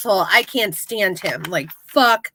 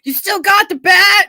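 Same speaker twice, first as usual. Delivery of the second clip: eager call; 8 semitones higher, 6 dB louder than usual. Retold or imitated. Acted quote, Steve dialogue.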